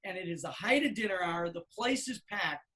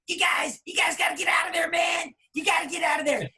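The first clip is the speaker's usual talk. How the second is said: higher, louder, fast frantic warning; urgent shout; 7 semitones higher, 4 dB louder than usual. Retold or imitated. Manager phones the band about the fire, urgent shouting. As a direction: yell it down the phone.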